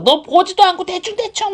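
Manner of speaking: high-pitched mock scolding; angry mom demo. Shown as a demonstration. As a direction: nag like an annoyed mom. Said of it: "막 화를 내시면서". (화내며 나무라는 엄마 말투)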